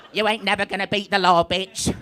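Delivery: camp comic impression, badly done; bad Alan Carr. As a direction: Essex captain slipping into a camp comedian. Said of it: "that shit Alan Carr impression that I just did at the end".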